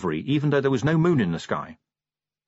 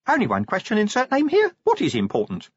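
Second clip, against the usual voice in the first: higher, chirpy little imp with insert name here squeaked up high; 11 semitones higher than usual. Acted. Imp questions Vimes.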